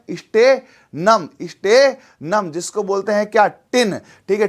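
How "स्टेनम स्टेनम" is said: Stannum stretched very slowly, syllable by syllable; stannum drawn out long and slow, each sound held; drawn-out name. Shown as a demonstration. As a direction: spell out stannum slowly for the class to copy. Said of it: "क्या नाम है?".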